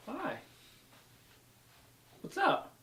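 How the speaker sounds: high, sing-song hello; high greeting. Real, not acted. Greeting someone waving outside.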